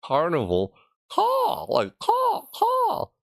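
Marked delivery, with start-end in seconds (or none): 1.1-1.7 very high crow squawk; crow caw. Acted. Streamer explaining the crow pun, cawing. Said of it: "Crows, like". 2.0-3.1 repeated, very high crow squawks; crow caw. Acted. Streamer explaining the crow pun, cawing. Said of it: "Crows, like".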